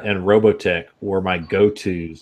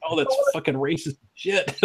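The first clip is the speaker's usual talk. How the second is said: laughing; 8 semitones higher, 4 dB quieter than usual. Real, not acted. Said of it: "now I'm like".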